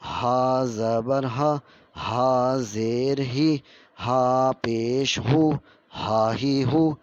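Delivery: slow, loud teacher's recitation; slow recitation drill. Shown as a demonstration. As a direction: spell out each syllable for students. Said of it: "آگے پڑھتے ہیں".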